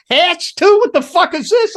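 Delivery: high, loud mock outrage at the hats; exasperated disbelief. Roadie retelling his disbelief at the hat rack, high exasperated outcry. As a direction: pitch it way up, fed up and incredulous. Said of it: "I'm like".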